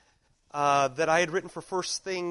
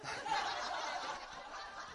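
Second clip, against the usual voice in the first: breathless laugh; 13 dB quieter than usual. Real, not acted. Laughs at daughter's remark.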